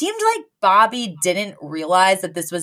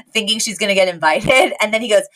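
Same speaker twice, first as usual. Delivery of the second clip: ordinary talk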